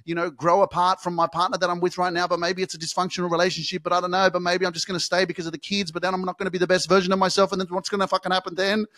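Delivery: fast, anxious run of excuses; hurried mock excuse-making; rushed excuses. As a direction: gabble the excuses like a worried doubter. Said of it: "You keep fucking saying".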